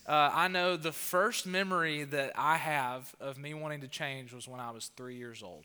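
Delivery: normal talking voice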